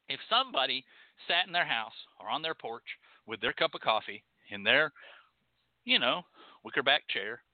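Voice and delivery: usual speaking voice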